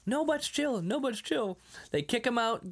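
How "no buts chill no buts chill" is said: mock shopkeeper. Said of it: "He goes into it again".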